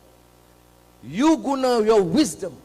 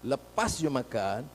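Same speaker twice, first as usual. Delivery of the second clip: ordinary talk, nothing marked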